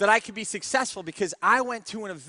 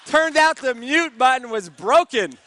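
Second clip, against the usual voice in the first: louder, slower, breaking into laughter; laughing through it; 6 semitones higher, 9 dB louder than usual. Real, not acted. Telling the audience the mute button was broken, laughing. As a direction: laugh out the punchline. Punchline of the Carol story.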